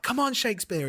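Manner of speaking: high cheering call; mock crowd call. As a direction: call him out like an excited crowd. Audience calling for the author.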